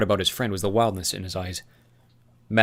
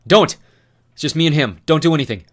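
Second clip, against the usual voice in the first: loud, higher stop shout; urgent shout; 7 semitones higher, 11 dB louder than usual. Acted. Thomas shouting to the van not to fire, urgent and loud. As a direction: call out to stop them.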